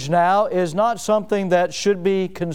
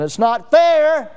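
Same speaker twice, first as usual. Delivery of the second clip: higher, louder and slow, a wounded protest; anguished cry; 8 semitones higher, 4 dB louder, slower than usual. Acted. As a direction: let the unfairness ring out. God voiced in sermon.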